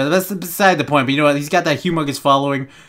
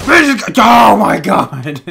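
real shock, dragged out long; drawn-out shocked gasp; 6 semitones higher, 7 dB louder, much slower than usual. Real, not acted. Gamer caught off guard by enemies, long loud shocked cry. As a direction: stretch "oh my god" out slowly and loud.

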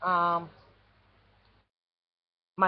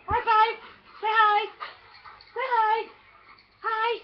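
high-pitched pet-trick voice; excited pet coaxing; 7 semitones higher than usual. Real, not acted. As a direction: coax a pet in a high, eager voice.

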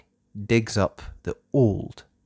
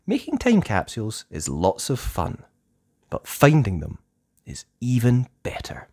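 plain reading voice

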